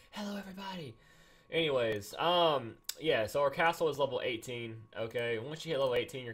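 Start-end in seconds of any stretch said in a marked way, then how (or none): 0.1-0.9 high, soft hello; small high greeting. Real, not acted. Streamer echoing his hello to everybody, small high voice. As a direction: repeat it tiny and pitched up. Repeats the greeting softer, higher.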